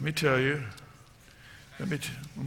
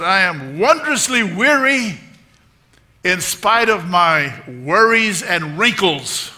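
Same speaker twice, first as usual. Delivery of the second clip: put-on slow, high, loud old-lady proclamation; hammed-up imitation of a spirited elderly woman; Dorothy Boyd; 4 semitones higher, 9 dB louder, slower than usual. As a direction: declare it grandly, stretching the words. Retold elderly woman's grand declaration.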